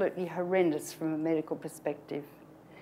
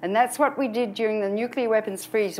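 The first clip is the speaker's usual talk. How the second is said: ordinary delivery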